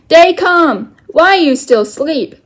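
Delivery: loud, high call to wake the sleeper; shouting. Acted. Little Bear shouts at sleeping Omri to get up. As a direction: bellow it across a canyon. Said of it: "the Indian shouted".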